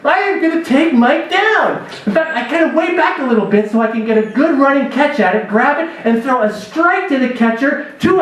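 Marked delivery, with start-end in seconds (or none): 0.0-1.8 higher, eager inner voice, psyched for revenge; pumped-up inner vow. Retold or imitated. Outfielder to himself, higher, eager and psyched. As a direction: hype yourself up to take him down. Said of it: "and I'm thinking".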